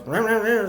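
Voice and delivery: high "rah, rah, rah" gibberish; high-pitched nonsense mumbling in imitation; imitated garbled speech. Mimics garbled intercom speech.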